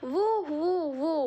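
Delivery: high "woo" wind noise; imitated howling wind. A narrator's reading. Storyteller voicing the strengthening wind, imitated howl. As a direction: whoosh out high woos like a gusting wind. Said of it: "ഈ കാറ്റിൻ്റെ ശബ്ദമാണ്".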